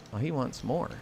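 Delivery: normal talking voice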